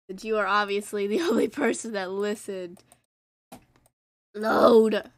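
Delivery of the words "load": sudden loud yell at the screen; loud one-word demand. Real, not acted. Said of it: "I'm screaming like a child".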